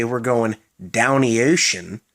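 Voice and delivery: "down the ocean" slurred into "downy ocean"; Maryland pronunciation. Shown as a demonstration. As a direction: run "down the" together as "downy", slowly. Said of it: "if you ever hear a Marylander say".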